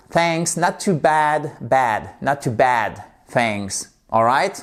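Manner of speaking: ordinary talk, nothing marked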